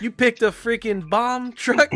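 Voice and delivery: high, slow, shocked question; hammed-up outrage at a bad choice; mock disbelief. Retold movie line, shocked.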